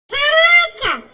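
high, loud wow; startled exclamation; surprised shout. Real, not acted. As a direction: shout out in shock. Shocked in the game.

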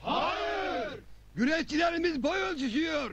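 high crowd shout back. Acted. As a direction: yell it from the crowd. Villager shouting back at speech. (kalabalıktan yüksek sesle bağırarak)

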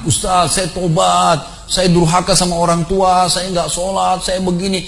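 high, drawn-out penitent plea; imitated tearful confession. Shaken man confessing to the ustaz before the congregation, imitated tearful plea.

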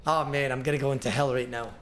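ordinary talk

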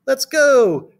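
high, loud excited call; shouting class. A narrator's reading. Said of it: "shouted the class".

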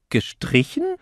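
very high, incredulous question; shocked disbelief. A narrator's reading. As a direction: echo the bad news in startled disbelief. Hears her horse is scratched. (ungläubig, entsetzt)

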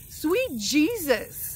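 drawn-out exclamation. Real, not acted. Relief exclamation.

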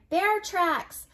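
high, loud excited shout; children crying out. A narrator's reading. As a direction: cry out the discovery like excited children. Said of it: "the children cried".

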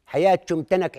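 ordinary talk

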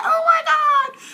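very high, loud mock squeal; imitated happy fan. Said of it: "she was so happy".

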